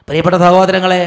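loud preacher's call to the flock. Real, not acted. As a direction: lift the voice to the whole church. Preacher calling out to congregation.

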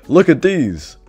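loud, high show-off imitation; mock boasting. Rival kid flaunting his biceps, mock boastful.

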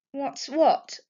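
feigned innocence, light and high; innocent smile. A narrator's reading. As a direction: play dumb sweetly. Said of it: "replied Jess with an innocent smile".

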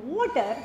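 "water" with an exaggerated w glide; rounded w. Shown as a demonstration. Teacher showing lip shape to class, "water" with a rounded w. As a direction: push the lips round for "water". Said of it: "look at my lips".

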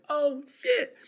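high, startled oh shit; shocked oh shit. Retold or imitated. Seeing the fighter smashed into the wall, high shocked blurt. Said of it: "I was like".

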